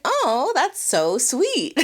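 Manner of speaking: slow, high and sugary; gushing sweetly. Retold or imitated. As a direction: swoon over a sweet gesture. Her own swooning reaction.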